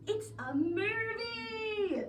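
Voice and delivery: high, slow director's shout; megaphone shout. A narrator's reading. Director shouting at the narrator through megaphone. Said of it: "through his megaphone".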